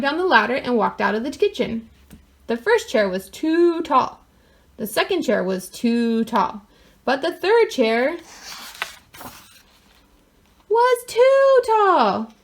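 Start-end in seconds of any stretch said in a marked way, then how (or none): 2.5-8.2 slow storytelling build. A narrator's reading. 10.7-12.3 drawn-out high punchline. A narrator's reading.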